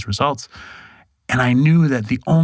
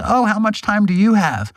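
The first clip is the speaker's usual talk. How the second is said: high-pitched, friendly curiosity; 9 semitones higher than usual. Retold or imitated. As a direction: imitate someone asking with friendly interest. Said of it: "he was really friendly".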